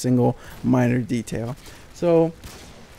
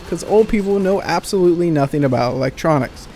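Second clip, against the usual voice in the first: ordinary delivery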